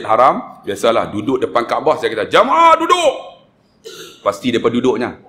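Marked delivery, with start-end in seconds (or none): 2.3-3.1 calling out to group. Retold or imitated. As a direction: call the pilgrims to order, voice pitched way up. Calling a pilgrim group to sit. (seruan lantang kepada jemaah)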